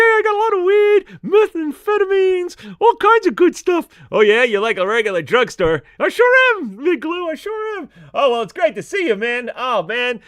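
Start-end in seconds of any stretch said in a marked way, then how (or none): 0.0-7.9 higher, louder goofy puppet character voice; a cheeky stoner puppet griping about the dog; Mister Rob puppet voice. Acted. Mister Rob the puppet complains to the host about the barking dog, in a higher, goofy puppet voice.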